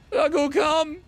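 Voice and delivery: high, loud Ragout, come in a fake French accent; mock French command; mock French dog call.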